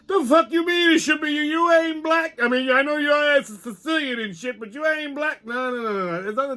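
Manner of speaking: high, cocky puppet voice, indignant; a brash puppet arguing about reparations; Pop the puppet. Acted. Brash puppet arguing with host, high and indignant.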